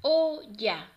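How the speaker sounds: loud "O-lla" in two beats; teacher segmenting "Olla" for children; syllable by syllable. Shown as a demonstration. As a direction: split "Olla" with a clear gap. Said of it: "vamos a separar cada una".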